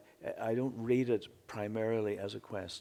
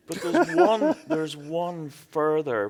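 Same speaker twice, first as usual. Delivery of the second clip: much higher and louder at first, then slowing x0.5 into laughter; laughing build-up; 4 semitones higher, 10 dB louder, slower than usual. Real, not acted. Poet laughing as he teases the audience with the next problem. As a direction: tease the punchline through a laugh.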